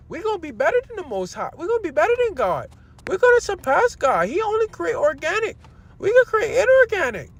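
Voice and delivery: high, fast, smug mock boast; mocking Harari. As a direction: ham up a tech prophet bragging he can outdo God. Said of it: "That's exactly how he said it".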